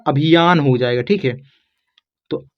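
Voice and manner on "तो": ordinary talk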